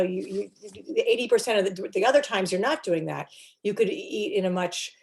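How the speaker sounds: ordinary talk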